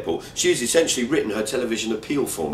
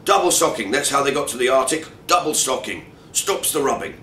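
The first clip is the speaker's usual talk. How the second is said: loud, higher bluff colonel voice; retired colonel; 6 semitones higher, 5 dB louder than usual. Retold or imitated. Friend gives hiking advice as a bluff retired colonel. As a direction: bark it like an old army colonel. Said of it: "sounds a little bit like a retired colonel".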